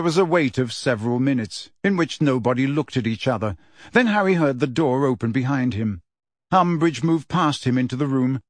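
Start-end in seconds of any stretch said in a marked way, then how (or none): none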